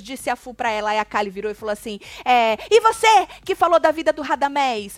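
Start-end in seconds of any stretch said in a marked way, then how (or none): 2.3-5.0 high, loud mock challenge; acted angry accusation. Kali turning on Fu, acted angry accusation. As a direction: confront them as in a retold row.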